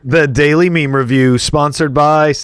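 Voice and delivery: slow announcer read; show announcer. Acted. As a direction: announce the segment title.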